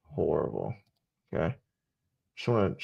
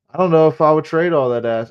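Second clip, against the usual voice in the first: excited; 6 semitones higher, 8 dB louder than usual. Real, not acted. Outburst over trade idea.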